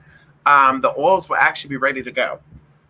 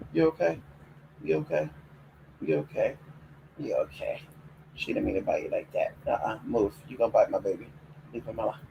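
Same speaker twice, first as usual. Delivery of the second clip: hushed, tender murmur to a hurt pet; soft cooing; 5 semitones lower, 11 dB quieter than usual. Real, not acted. Owner soothes the bitten dog, hushed cooing. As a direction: whisper comfort down low and soft.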